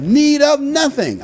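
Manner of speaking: smug boast. Acted. Laodiceans boast in scripture.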